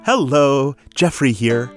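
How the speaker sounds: ordinary talk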